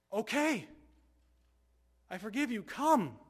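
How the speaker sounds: high, loud, open-armed welcome; Jesus forgiving. Acted. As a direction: call the sinner home with joy. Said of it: "And you know what he says?".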